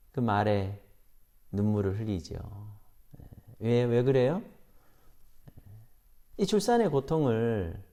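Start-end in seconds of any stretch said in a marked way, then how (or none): none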